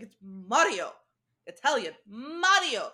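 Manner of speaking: "Mario" said the Italian way, MAH-rio with a tapped r, loud and insistent; correcting to "Mario" with an Italian MAH-rio. Retold or imitated. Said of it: "how you're Italian".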